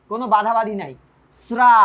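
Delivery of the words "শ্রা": শ্রা held long on an open "aa"; drawn-out syllable. Shown as a demonstration. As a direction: stretch শ্রা out freely, "sraaa". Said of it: "টানা যায়।". (টেনে "শ্রা-আ")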